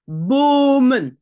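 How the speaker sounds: Bomen avec un O long, fort et appuyé; long O demo. Shown as a demonstration. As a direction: fais entendre le O long de Bomen. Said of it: "Je n'ai qu'un M". (Bomen, O bien tenu)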